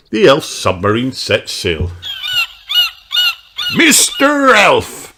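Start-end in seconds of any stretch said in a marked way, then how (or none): 3.7-5.1 slow, high, loud pirate bellow; bellowed order. Acted. Redbeard calls to Mister Elf. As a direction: bellow the name like a captain. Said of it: "bellows Redbeard".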